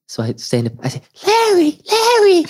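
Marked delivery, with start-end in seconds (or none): 1.2-2.5 put-on very high, squeaky child voice, louder; excited kid calling. Ten-year-old calling to Larry Bird, high squeal. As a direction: call out like a little fan.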